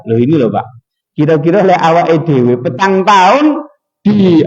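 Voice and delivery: ordinary talk, nothing marked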